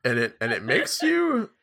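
put-on wavering old guy voice with big pitch swoops; kooky old Albus Dumbledore. Old wizard announcing a discovery to the students, kooky old-man voice. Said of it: "an old guy voice for him".

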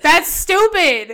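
loud, drawn-out real annoyance; exasperated. Real, not acted. Guest venting to host about her ex, exasperated.

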